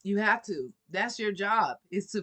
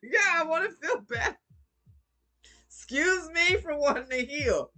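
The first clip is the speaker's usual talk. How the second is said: sassy mock apology; 8 semitones higher than usual. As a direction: sarcastically apologize for healing. Her mocking reply to haters.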